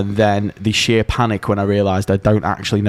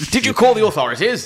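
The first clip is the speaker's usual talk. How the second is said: mock concerned toff; 12 semitones higher, faster than usual. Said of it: "put on a fake moustache".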